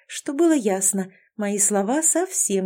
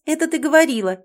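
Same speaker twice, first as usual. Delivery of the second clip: interrupting; 7 semitones higher, 5 dB louder, faster than usual. Acted. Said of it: "перебила она".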